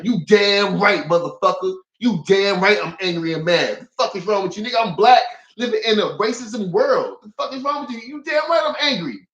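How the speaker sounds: high-pitched defiant rant; angry retort. Retold or imitated. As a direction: fire back indignantly, pitch climbing toward the end. Answering the quoted insult with anger.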